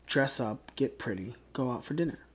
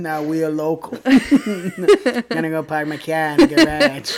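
put-on Boston accent; 3 semitones higher, 10 dB louder, slower than usual. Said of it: "that was way more Bostonian".

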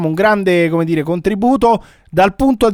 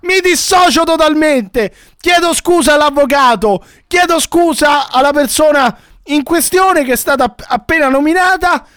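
agitato, voce molto più alta; protesta accalorata; 9 semitones higher than usual. Acted. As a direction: dissociati alzando la voce, quasi gridando. Conduttore si dissocia, sdegnato e agitato. (protesta concitata, a voce alta)

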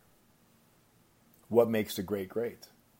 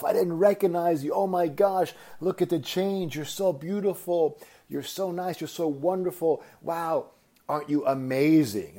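high-pitched, overwhelmed father, retold; mock weeping; 7 semitones higher than usual. Farmer recognizes his lost daughter, imitated tearful amazement. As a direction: gush through pretend tears, pitched well up. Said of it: "He begins to weep and begins to cry".

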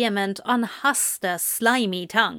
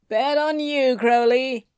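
raised, put-on voice of grudging praise; reluctant respect; 4 semitones higher than usual. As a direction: hand over the praise grudgingly, pitched up. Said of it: "praises with reluctant respect".

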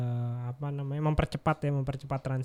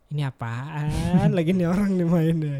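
incredulous laughing quote; 5 dB louder than usual. Retold or imitated.

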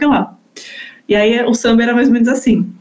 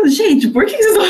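put-on child's voice, high and puzzled; a serious little girl, bewildered; criança sisuda indignada; 8 semitones higher, much faster than usual. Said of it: "eu ficava". (criança séria perguntando, agudo)

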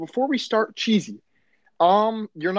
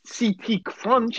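slow mocking repeat of C T Crunch, stretched; 5 semitones higher, much slower than usual. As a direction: drag out C T Crunch in disbelief. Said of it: "no one calls it".

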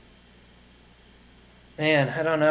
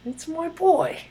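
much higher put-on voice, quicker; hammed-up high movie voice; second try at the impression; 12 semitones higher, slower than usual. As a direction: squeak it out as a sillier retry. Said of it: "I don't know what the hell I was doing there".

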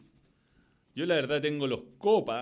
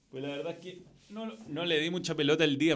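ordinary delivery

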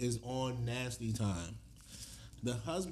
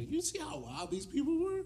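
high incredulous quote. Retold or imitated. Couple gossiping at dinner.

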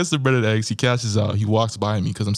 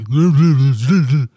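laughing out loud, high and loud; cracking up mid-story; loud burst of laughter; 5 semitones higher, 9 dB louder than usual. Real, not acted. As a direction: break into a big laugh. Laughs while imitating the man.

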